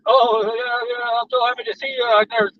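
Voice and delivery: mock doggy adoration, voice lifted; happy dog. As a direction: gush like a dog overjoyed to see you. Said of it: "as soon as you walk in the door, they're like".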